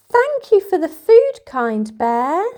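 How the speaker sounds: high, loud grateful bird voice; high-pitched chirp. Acted. Little bird thanking the kind bear, high chirp. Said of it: "he heard a high-pitched chirp".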